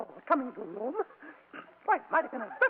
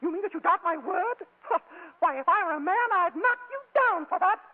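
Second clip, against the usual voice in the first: affronted falsetto lady; huff, then build to an indignant threat; put-on lady losing the temper; nasty temper; 11 semitones higher than usual. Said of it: "you do have a nasty temper".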